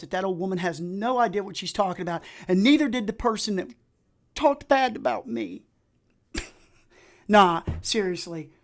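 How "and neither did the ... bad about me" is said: indignant punchline. Real, not acted.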